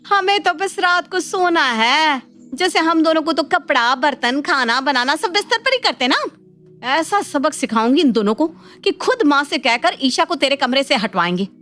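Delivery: high-pitched sarcastic mimicry of husbands; indignant mockery. Elder sister-in-law fuming about both husbands, indignant and mocking. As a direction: throw their words back with scorn.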